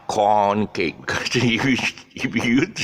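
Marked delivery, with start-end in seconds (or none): 0.1-1.0 corn held long and open, cake after it; long vowel in corn. Shown as a demonstration. Teacher modelling corn cake for learners. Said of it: "pronounce the corn long sound".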